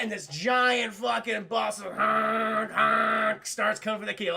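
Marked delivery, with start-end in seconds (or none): none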